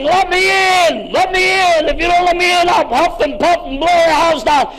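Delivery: big bad wolf demanding. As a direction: pound the door and demand entry as the wolf. Wolf banging at pig's door.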